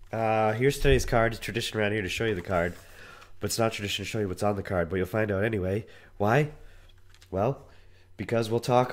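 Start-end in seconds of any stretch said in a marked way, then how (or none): 1.3-5.8 very fast rattled-off aside. Real, not acted. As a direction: rattle it off quickly.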